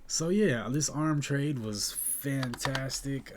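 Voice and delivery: pumped about the win; excited. Real, not acted. Excited about good trade.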